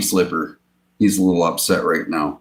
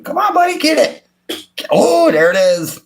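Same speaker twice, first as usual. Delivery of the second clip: much higher coaxing pet voice; high pet talk. Real, not acted. Owner urges his fish to grab the food in pet talk.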